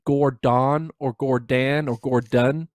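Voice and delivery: Gordon three ways: gor-DON, gor-DAN, gor-DUN; vary the second vowel of each Gordon, slowly; deliberate mispronunciations. Shown as a demonstration. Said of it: "said my name the way I normally don't say it".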